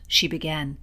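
usual speaking voice

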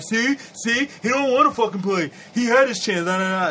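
mock Twitter partisan. Imitating MAGA Twitter gloating over Kaepernick, mocking role voice. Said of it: "MAGA Twitter talking about".

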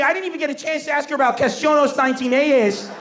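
ordinary talk, nothing marked

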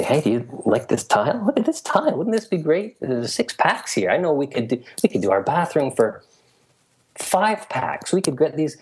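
excited pitch. Retold or imitated. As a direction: sell the tile idea, thrilled. Excited spouse pitching tile idea.

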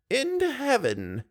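put-on high, slow, serene old lady. A narrator's reading. Old lady calmly tells Raoul, high and slow. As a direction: say it in simple faith.

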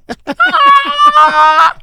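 loud high burst of laughter; laughing shout. Real, not acted. Laughing after mock announcer bit.

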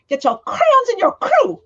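teacher's thick German accent, retold with a grin. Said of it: "She had the thickest accent".

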